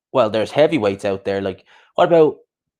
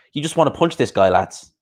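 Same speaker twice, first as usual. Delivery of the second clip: ordinary talk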